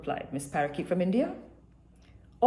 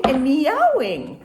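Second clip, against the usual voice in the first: loud; 6 semitones higher, 8 dB louder, slower than usual. A narrator's reading. Animal din building.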